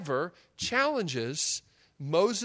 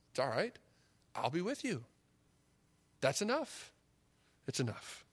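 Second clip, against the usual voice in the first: tender reassurance; 3 semitones lower, 6 dB quieter than usual. Acted. God reassures the doubter.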